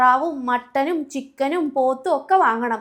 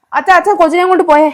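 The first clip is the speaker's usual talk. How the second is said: loud, fast, higher shout for father; frantic shout; 3 semitones higher, 11 dB louder, faster than usual. Acted. Wife shouting for her father to take the baby, loud and fast. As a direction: yell for help across the house.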